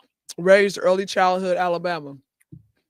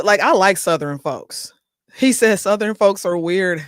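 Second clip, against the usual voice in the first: usual speaking voice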